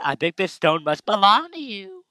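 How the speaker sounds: put-on squeaky young girl reading her dialogue; girly game character. Voices Lily again.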